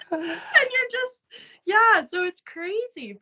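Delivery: high, laughing through the words; giggling, slow and disbelieving; words squeezed out through real laughter. Real, not acted. Laughing at the coincidence.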